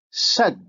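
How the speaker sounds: Sad with emphatic s and backed vowel; Arabic emphasis demo. Shown as a demonstration. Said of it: "look at the examples".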